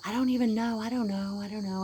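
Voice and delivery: mock exasperation, pitched high; high, loud opening that eases off as it repeats; exasperated retort. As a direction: imitate a fed-up mother brushing off a question. Acted retort of careless mother.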